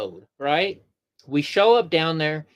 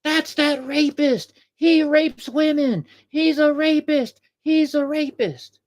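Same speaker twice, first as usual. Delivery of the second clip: high, slow mocking girl's voice; retelling the protester girl's accusation in a high, slow mocking voice; mock screaming protester girl; 9 semitones higher, slower than usual. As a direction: mimic the girl pointing and accusing, pitched way up. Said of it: "starts screaming".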